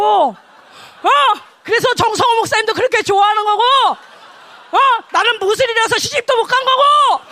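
loud, high hammed-up grievance; acted outburst of wounded jealousy; jealous rant. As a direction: shout it out as a mock self-pitying tirade.

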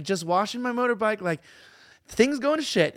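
high, chirpy Australian-style lilt; high intonation. Retold or imitated. Imitating brother-in-law reporting disasters by phone, high happy intonation. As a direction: deliver bad news in a high, happy singsong. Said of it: "everything's in the high intonation".

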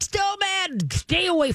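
high sharp warning that drops back down; mom dog warning. Retold or imitated. Mother dog warning her pup to stay away. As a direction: snap it at the pup, then settle. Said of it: "and they're up".

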